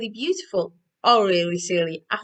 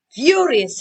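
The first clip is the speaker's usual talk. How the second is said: gasp; 7 semitones higher, 9 dB louder than usual. A narrator's reading. Giant wakes, reads dramatically.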